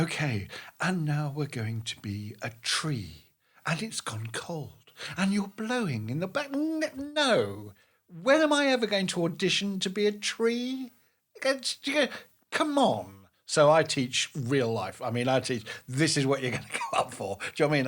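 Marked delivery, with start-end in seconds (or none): 0.0-6.5 slow, half-whispered acting-class instructions; mock drama teacher. Mimicking a drama class exercise. 6.5-13.1 high-pitched, scornful disbelief; mock exasperation. Scoffing at the tree exercise.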